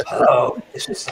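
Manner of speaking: husky, rasping politician impression; rough-voiced public figure saying hello; Nelson Rockefeller. Shown as a demonstration. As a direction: do a playful impression with a croaky hello. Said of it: "I could do Nelson Rockefeller".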